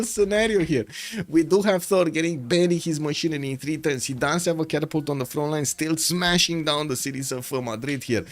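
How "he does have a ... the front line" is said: usual speaking voice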